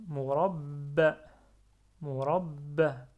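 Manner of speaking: "murabba" fort et lent, b redoublé et retenu; shadda. Shown as a demonstration. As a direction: retenir le b de "murabba" avant de lâcher la finale brève. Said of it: "et je garde shadda". (« murabba » avec shadda appuyée sur le b)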